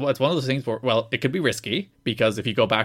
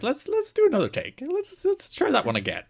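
high mock director. Imagined director asking retake.

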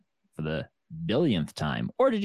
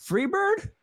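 a far higher incredulous performer voice; surprised question. Retold or imitated. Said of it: "And he was like".

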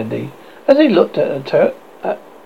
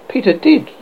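ordinary delivery, nothing marked

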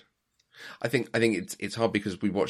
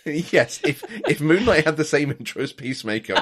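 laughing through the words, a bit louder; amused, laughing while answering; 4 semitones higher, 6 dB louder than usual. Real, not acted. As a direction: chuckle your way through it. Laughing reply about the intro.

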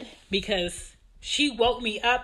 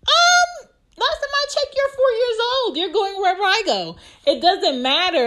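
much higher and louder, fed-up retort; high, loud, sarcastic mom comeback; exasperated mom; 13 semitones higher, 7 dB louder than usual. Retold or imitated. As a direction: shut down a pointless question from your four-year-old. Mom snapping at her four-year-old.

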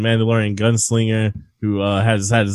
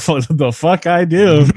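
baffled exclamation; 6 semitones higher, 5 dB louder than usual. Retold or imitated. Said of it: "I'm like".

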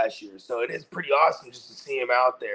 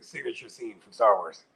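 ordinary talk, nothing marked

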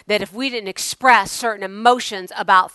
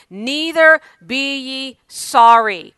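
slow and emphatic, louder; slow scripture quote; 4 semitones higher, 6 dB louder, much slower than usual. Acted. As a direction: read each word slowly. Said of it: "Nehemiah goes on to say".

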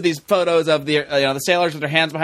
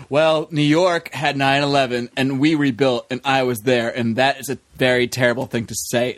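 lower, slow and grave; somber Donald Trump; 4 semitones lower than usual. Retold or imitated. Somber Trump answering Ted Cruz's attack on New York, grave and calm. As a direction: bring it down, solemn and measured. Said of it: "He got, like, super somber".

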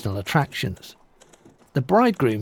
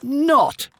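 angry retort; 12 semitones higher than usual. Acted. Giantess defies the mayor.

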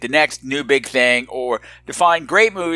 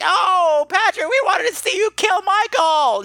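mock disappointed listeners. Podcaster voicing his disappointed listeners, mock whining. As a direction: moan it high like a let-down crowd. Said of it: "Everyone's so disappointed".

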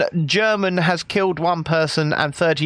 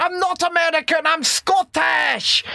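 mock Scottish shouting; 12 semitones higher than usual. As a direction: shout it like an over-proud Scot, stretching Scottish. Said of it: "he puts the accents on".

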